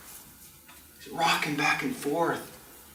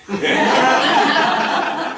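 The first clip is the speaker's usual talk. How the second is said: loud high laugh; 9 semitones higher, 7 dB louder than usual. Real, not acted. Comedian laughing at his own story. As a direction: burst out laughing.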